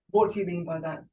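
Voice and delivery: ordinary talk, nothing marked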